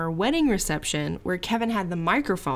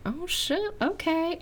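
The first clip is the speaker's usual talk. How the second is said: mock startled; 9 semitones higher, 3 dB quieter, slower than usual. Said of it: "I was like".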